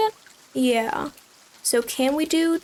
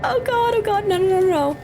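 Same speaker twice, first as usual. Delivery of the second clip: terrified gibbering; 6 semitones higher than usual. Real, not acted. Scared in horror game.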